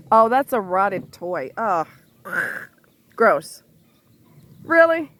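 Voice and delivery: loud grossed-out reaction; disgusted. Real, not acted. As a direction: recoil at something rotten. Disgust at rotted toy.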